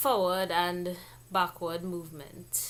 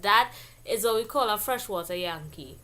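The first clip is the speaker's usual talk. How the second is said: usual speaking voice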